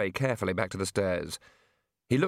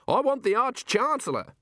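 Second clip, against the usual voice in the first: high, insistent demand; snapping; 13 semitones higher than usual. A narrator's reading. Wizard below demanding the Arch-Chancellor, snappish. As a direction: snap out the demand, pitched well up. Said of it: "snapped the wizard".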